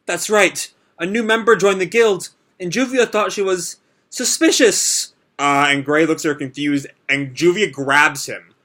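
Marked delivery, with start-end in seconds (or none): 0.1-5.1 higher, strange, drifting voice; girl speaking as if in a trance; eerie trance voice. Retold or imitated. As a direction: sound possessed, far away. Juvia in a trance.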